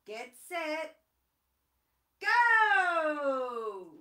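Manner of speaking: loud, high starting shout; starter's shout. Acted. Kookaburra shouting the start of the race. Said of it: "said the Kookaburra".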